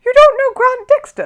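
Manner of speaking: very high and loud amazement; astonished. A narrator's reading. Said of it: "exclaimed Paddington".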